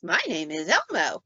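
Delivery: swooping toy-character voice; sing-song imitation of a talking toy; Elmo. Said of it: "After you've heard for the four hundred and thirty-seventh time".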